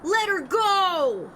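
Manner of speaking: high, slow and firm demand; commanding hero. Acted. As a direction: tell the kidnapper to release her. Voicing game hero in standoff.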